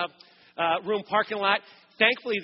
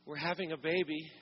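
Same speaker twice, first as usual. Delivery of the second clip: soft, gentle imitation of the wife, much softer; real quiet, gentle; 4 semitones lower, 9 dB quieter than usual. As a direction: say "We're having a baby" hushed and gentle, as if calmly breaking news. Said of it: "like, real quiet, real gentle".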